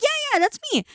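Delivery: retold eager answer; excited reply. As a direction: pitch way up, happy to be remembered. Answering the recognising owner.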